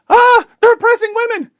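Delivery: high shrieking mock scream; hammed-up high panicked yell. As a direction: shriek it like a hysterical reporter. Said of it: "goes to the Taliban and screams".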